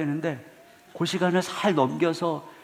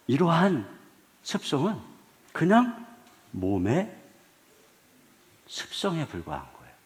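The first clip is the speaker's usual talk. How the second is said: usual speaking voice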